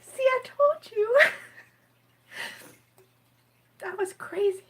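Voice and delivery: high, slow teary voice; words breaking through tears; crying. Real, not acted. Admitting to viewers she is crying, teary and slow. Crying on livestream after reading.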